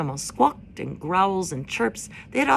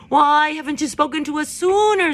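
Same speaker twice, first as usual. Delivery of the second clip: higher, louder demanding screech; screeching owl; 10 semitones higher, 8 dB louder than usual. A narrator's reading. Owl screeching at Roz from the tree, higher and louder. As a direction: screech it down from high up. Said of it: "screeched the owl".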